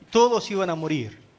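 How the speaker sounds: ordinary delivery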